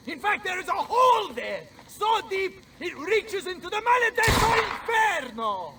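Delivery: high, loud taunting insult; mocking shout. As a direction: yell the mockery across the battlefield.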